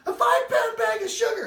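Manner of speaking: high, loud frantic shout; retold shout. Wife shouting the news down the phone. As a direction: blurt it out in panic.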